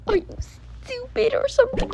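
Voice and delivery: high, quick mocking jab; snide jab. Real, not acted. Sister mocking her sibling over honor roll.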